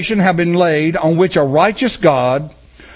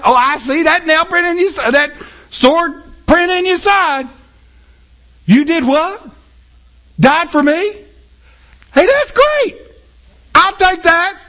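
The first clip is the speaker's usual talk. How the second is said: much higher, offhand delight; a careless sinner shrugging at the cross; breezy sinner; 8 semitones higher than usual. Pretend. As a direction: shrug off a great gift lightly. Sinner casually accepts salvation.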